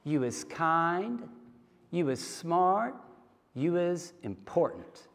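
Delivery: slow, soft and tender, each phrase lifting; nanny's soft slow blessing to the little girl; gentle affirmation. Retold or imitated. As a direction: look a child in the eyes and reassure her. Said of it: "looks her in the eyes and she says".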